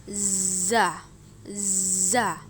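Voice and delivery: "Za" with a long wasp-like buzz on the z. Shown as a demonstration. Teacher shows the letter za, "Za" buzzed like a wasp. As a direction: buzz like a wasp before opening into "za". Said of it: "mirip dengan suara dengungan tawon".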